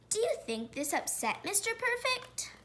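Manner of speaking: ordinary delivery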